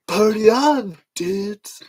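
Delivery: high, loud slacker party cry; stretched-out, whooping catchphrase; Wayne impression. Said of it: "so I get that impression right".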